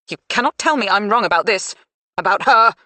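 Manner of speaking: loud, rising insistence; insistent. A narrator's reading. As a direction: refuse to be contradicted. Mother insists loudly.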